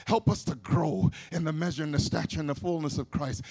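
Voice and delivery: ordinary delivery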